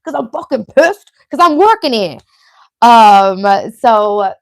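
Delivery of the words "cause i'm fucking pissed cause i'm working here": hammed-up Long Island accent, building louder and higher. Shown as a demonstration. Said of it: "my Long Island accent coming out".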